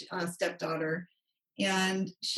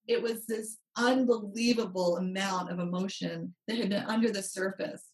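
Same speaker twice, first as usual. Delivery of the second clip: ordinary talk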